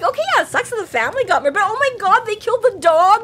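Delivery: high, fast, laughing rant; laughing outrage. Retold or imitated. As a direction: rant about the dog while laughing. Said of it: "We're like".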